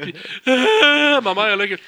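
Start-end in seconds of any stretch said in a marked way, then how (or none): 0.5-1.2 high, loud mock sob; re-enacted crying. Host re-enacting crying alone in the kitchen, loud wail. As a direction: wail it as if bursting into tears. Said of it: "j'ai vraiment pleuré sur Eddie Guerrero".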